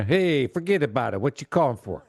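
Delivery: hammed-up New York Italian greeting; New York Italian accent. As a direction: put on a New York Italian phone manner. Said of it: "a New York Italian accent".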